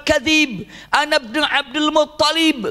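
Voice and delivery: high, slow proclaiming call; shouting out. Retold or imitated. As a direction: proclaim who you are across the field, high and drawn out. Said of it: "Rasulullah berteriak mengatakan". (teriakan tinggi dan lambat)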